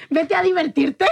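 tipsy cheer. Retold or imitated. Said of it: "yo le dije".

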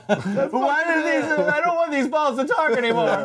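high voice breaking into laughter; laughing mock complaint. Comic riffing on regretted talking balls, high laughing complaint. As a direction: complain in a high voice while cracking up.

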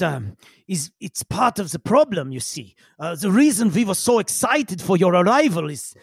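put-on accented researcher character, earnest and halting at first; eccentric researcher. Game master as massive-headed researcher explains the problem, earnest character voice. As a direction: play an odd scientist explaining a problem.

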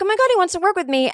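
high, fast, thrilled rush; excited gush. Retold or imitated. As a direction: blurt it out thrilled. Retold excited inner reaction.

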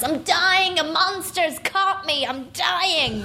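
high, wailing mock death scene; dramatic dying woman. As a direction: ham up a dying actress. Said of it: "collapses onto the ground and says".

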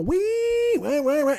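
high squealing wee into wah wah wah; guitar squeal imitation. Fan imitating [name]'s solo to listeners, high vocal squeal. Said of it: "he's this big squeal".

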